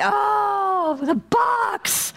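high mock wail, hammed up. Believer stuck in the box, high mock wail. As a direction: cry out in mock despair over the box. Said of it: "you're like".

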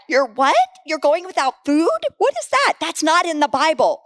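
mock disbelief, pitch leaping high; imitating shocked parents. Preacher voicing parents hearing of teens fasting, mock shock. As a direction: play a parent's rising, high-pitched alarm.

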